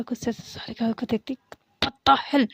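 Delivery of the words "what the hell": louder irritated snap; sharp exasperated cry; annoyed outburst. Acted. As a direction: let the anger spill out. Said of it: "बहुत गुस्सा आ जाता है".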